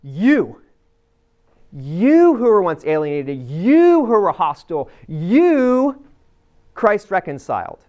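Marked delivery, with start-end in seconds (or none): 1.7-6.1 each "you" pushed out loud, the last "you" leaping high; stress on you. Shown as a demonstration. Preacher stressing "you" to the Colossians, loud and emphatic. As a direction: punch every "you" in the phrase. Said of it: "there is a unique emphasis on the you".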